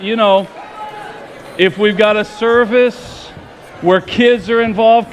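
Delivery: ordinary talk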